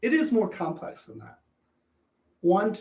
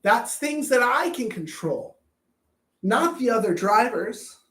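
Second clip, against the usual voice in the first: normal talking voice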